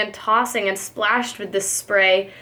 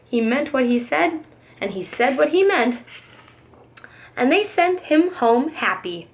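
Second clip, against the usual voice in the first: plain reading voice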